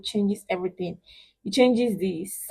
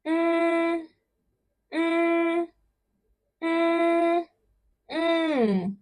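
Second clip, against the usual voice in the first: high, steady held hum; level humming; 9 semitones higher, 4 dB quieter, much slower than usual. Shown as a demonstration. Teacher cueing solo practice, level humming. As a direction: hum long on one high note. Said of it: "try to pronounce this on your own".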